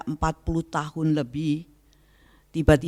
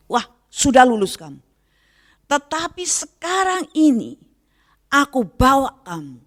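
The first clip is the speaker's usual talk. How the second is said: higher, fervent voice of God speaking to her; raised, lightly acted divine voice; 10 semitones higher, 4 dB louder than usual. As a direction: speak as God gently rebuking.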